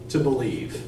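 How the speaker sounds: ordinary talk, nothing marked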